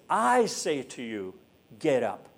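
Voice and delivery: "I" stressed and drawn out slowly; emphasis on I. Shown as a demonstration. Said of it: "the emphasis is".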